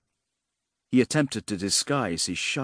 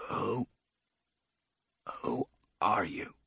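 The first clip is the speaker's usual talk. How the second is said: soft, slow, halting croak; 2 semitones lower, 7 dB quieter, slower than usual. A narrator's reading. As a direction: struggle to get it out hoarsely. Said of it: "he managed, croaking".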